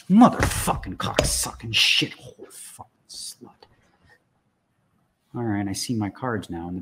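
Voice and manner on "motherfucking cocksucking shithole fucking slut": slow, rough string of curses losing its voice; drawn-out swearing fading to a whisper; strained cursing. Real, not acted. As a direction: curse slowly through mounting rage. Venting at broken computer.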